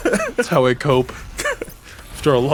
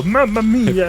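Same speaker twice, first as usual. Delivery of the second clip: higher, hammed-up Italian "Mamma mia"; a cartoon Italian exclaiming, pitched up; mock Italian; 6 semitones higher, slower than usual. Dad teaching the adopted kid, in a hammed-up Italian accent. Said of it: "you gotta go".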